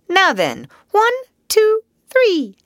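high, loud count-in; high-pitched call to start the carol; young caroler's count-in. A narrator's reading. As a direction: count the singers in brightly and high.

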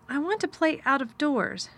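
a touch timid, keeping it steady; trying not to tremble. A narrator's reading. Girl answering her guardian's question, mildly nervous but controlled. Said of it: "hoping that her voice did not tremble".

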